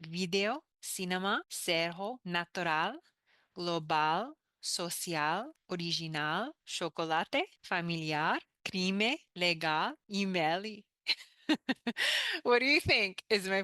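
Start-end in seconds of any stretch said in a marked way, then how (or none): 0.0-10.6 learner's try at Portuguese, vowels full, rising word ends; careful Portuguese learner reading words; Portuguese pronunciation. Shown as a demonstration. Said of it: "My understanding of the Portuguese pronunciation". 11.5-12.4 sheepish laugh. Real, not acted. Laugh after her Portuguese attempt.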